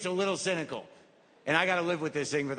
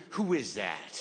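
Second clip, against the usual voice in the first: mystical wonder; 8 semitones lower, slower than usual. Retold or imitated. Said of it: "it went from ... to".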